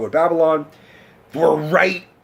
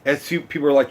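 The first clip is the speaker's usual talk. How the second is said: ordinary delivery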